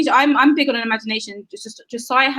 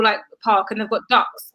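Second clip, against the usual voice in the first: usual speaking voice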